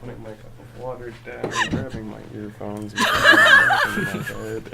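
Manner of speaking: talking away from the mic; walking away. Real, not acted. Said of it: "as I walk away from the microphone".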